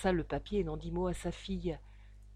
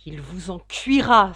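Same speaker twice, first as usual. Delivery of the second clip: slow, much louder threat; pale with rage; 13 dB louder, slower than usual. A narrator's reading. Scorned suitor threatens the father in rage. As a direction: spit out the threat slowly. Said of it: "s'exclama Stangerson, blême de rage".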